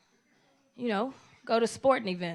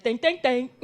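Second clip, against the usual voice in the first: mimicking other comics; 6 dB louder than usual. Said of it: "They be like".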